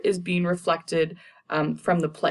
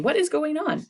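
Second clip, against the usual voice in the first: much higher, falsely innocent question; feigned alarm; 11 semitones higher than usual. Retold or imitated. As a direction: play Lady Macbeth pretending she knows nothing, voice jumping up. Lady Macbeth feigns innocent alarm.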